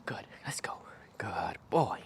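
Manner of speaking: soft whisper to the pup; whispered praise. Real, not acted. Trainer quietly praising puppy in a whisper.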